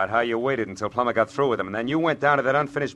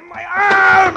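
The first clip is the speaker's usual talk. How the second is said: a high, loud yelp of pain; sudden shout of pain from a twisted arm; pained cry; 12 dB louder than usual. Acted. Thug's arm twisted in fight.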